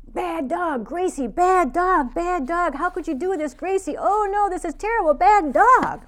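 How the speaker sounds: high, loud scolding of the dog; angry scolding. Retold or imitated. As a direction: scold the dog in a burst of anger. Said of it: "I launched into a fit of anger".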